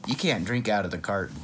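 ordinary delivery, nothing marked

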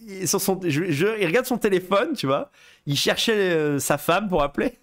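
normal talking voice

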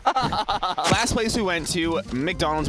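slow words through laughter; laughing. Real, not acted. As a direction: laugh as you say it. Laughing while speaking.

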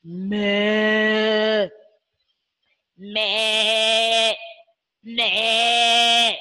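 low, long wavering bleat; low pretend goat cry. Shown as a demonstration. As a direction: imitate a goat, drawing out each bleat. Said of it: "How does it bleat?".

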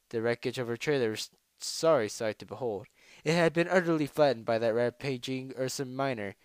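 ordinary delivery

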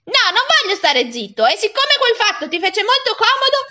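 put-on high, insistent boy arguing back; indignant boy. Boy character refuses to keep quiet.

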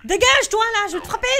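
high loud panicked shout; panicked yell. Real, not acted. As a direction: yell at it, half laughing. Jump scare, yelling at monster.

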